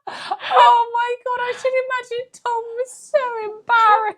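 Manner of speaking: high laughing cry of disbelief; squealing with laughter while talking; helpless laughter. Real, not acted. Reacting to story, laughing.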